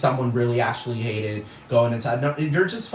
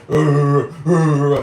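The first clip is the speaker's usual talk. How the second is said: mocking dumb bouncers; 6 dB louder than usual. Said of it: "they're just like".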